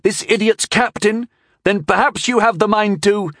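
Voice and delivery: high-pitched, scornful questioning; sneering boy. A narrator's reading. Boy mocks the stranger as idiot's captain, sneering. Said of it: "sneered the boy".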